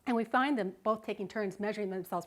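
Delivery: ordinary talk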